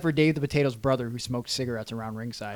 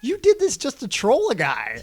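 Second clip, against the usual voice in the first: higher, louder mocking voice; mock teasing friend; 11 semitones higher, 7 dB louder, slower than usual. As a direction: needle him in a raised teasing voice. Friend teasing him in the van.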